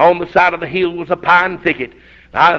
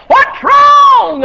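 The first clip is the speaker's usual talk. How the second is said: very high, loud alarmed yell; wife yelling from the fence; wife hollering; 7 dB louder than usual. Retold or imitated. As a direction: call out across the yard in alarm. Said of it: "ran to the fence and hollered".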